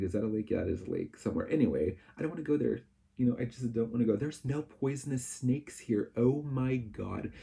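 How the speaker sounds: usual speaking voice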